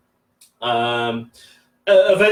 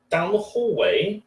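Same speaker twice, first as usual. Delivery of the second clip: ordinary delivery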